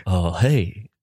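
low mumbly [name] as Mike Judge. Acted. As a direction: drawl it like a cartoon [name]. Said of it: "What is that, Mike?".

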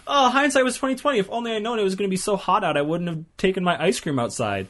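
high, fast mocking whine; whiny high voice. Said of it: "You're like".

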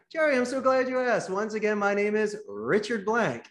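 light up as if delighted to be asked; eager salesman reply. Acted. Salesman answers the boss's question.

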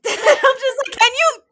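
high, loud, laughing plea; laughing exasperation. Retold or imitated. Laughing at Chanwoo's excuse, loud laughing plea. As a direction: laugh out the disbelief.

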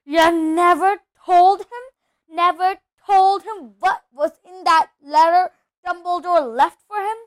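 high, loud, furious accusation; enraged. A narrator's reading. Hagrid accuses Dursley of hiding the letter, enraged. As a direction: rail at him, building to a shout. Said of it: "his every syllable trembled with ye-, rage".

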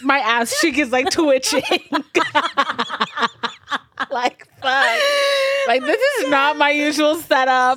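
laughing through a leg cramp; cramping and laughing, a bit loud. Real, not acted. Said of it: "I'm cramping really bad".

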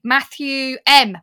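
normal talking voice

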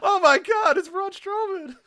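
very high, loud shrieking call; screaming announcer. Pretend. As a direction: shriek it like a stunned commentator. Mock commentator call.

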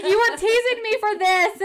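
Andy laughing at the bubble bath she was teased about; laughing accusation. Retold or imitated. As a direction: squeal it through a laugh.

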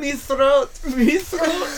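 high, loud silly voice; mock pirate voice. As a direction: echo it back in a goofy brogue. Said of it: "me throat".

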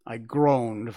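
ordinary delivery

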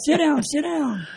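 high, drawn-out urging; excited like little girl. Retold or imitated. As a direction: imitate a starstruck officer, high and slow. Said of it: "like he was a little girl".

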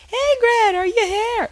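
put-on loud high English-accented call from the street. Friend shouting up from the street, loud English accent. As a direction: yell up to the balcony like the Englishwoman. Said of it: "in her a-, English accent".

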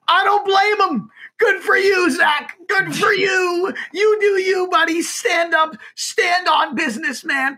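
very high, squeaky nerdy fan voice. Retold or imitated. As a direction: shriek it like a nerdy superfan. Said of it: "in that, like, nerdy voice".

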